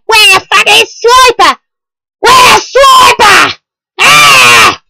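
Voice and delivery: loud, high shouted search for Swiper; mock panicked yell. Still hammed-up, yelling where Swiper went. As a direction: bellow it like the villain vanished.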